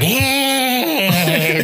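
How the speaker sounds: high squeaky mock cry; a hammed-up squeaky vampire hey. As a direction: squeal it like a flailing puppet. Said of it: "the Barlow is like".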